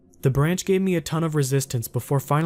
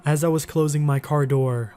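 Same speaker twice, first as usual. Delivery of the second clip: usual speaking voice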